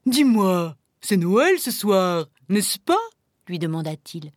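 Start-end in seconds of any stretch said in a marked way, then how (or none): 0.1-3.0 lightly voiced jovial bear; good-humoured bear. A narrator's reading. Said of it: "l'ours semblait de bonne humeur". (voix d'ours de bonne humeur)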